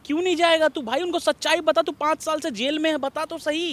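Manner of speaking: high-pitched, exasperated pushing; insistent urging. Acted. Friend urging him to face his mother, insistent. Friend presses reluctant man to go.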